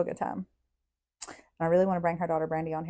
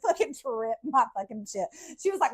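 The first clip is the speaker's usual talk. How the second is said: ordinary talk, nothing marked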